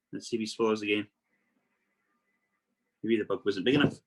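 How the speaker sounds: ordinary talk, nothing marked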